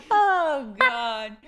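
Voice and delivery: high, loud burst of laughter; laughing hard. Real, not acted. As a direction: crack up completely. Breaks up laughing.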